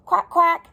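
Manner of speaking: high, loud spoken quacks; duck quack. A narrator's reading. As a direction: quack loudly as the storybook ducks. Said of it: "said the ducks".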